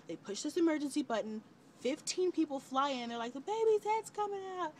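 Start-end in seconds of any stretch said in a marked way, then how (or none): 3.3-4.7 much higher, louder cry of alarm; alarmed shout. Retold or imitated. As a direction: shout it as the room floods in. Staff rushing in, alarm.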